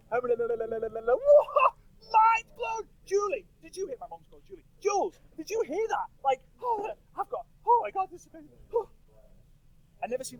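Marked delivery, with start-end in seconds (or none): 0.1-8.9 high-pitched, breathless excitement to his wife; dad in the car telling his wife Julie, squealing with excitement; imitating dad excited. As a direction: call out to Julie in giddy disbelief. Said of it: "my dad being like".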